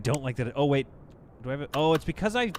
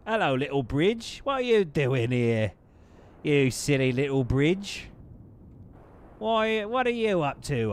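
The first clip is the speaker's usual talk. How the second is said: put-on playful British accent cooing at a little road bridge; 3 semitones higher, 3 dB louder, slower than usual. Streamer chatting to a bridge in a mock British accent. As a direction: sweet-talk the bridge in a slow British accent, pitch way up. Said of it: "I'm in trouble for my accent work".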